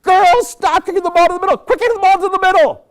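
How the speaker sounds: high, loud sideline yell; screaming coach. Retold or imitated. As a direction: scream across the pitch at the girls. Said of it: "her coach Shawnee is screaming".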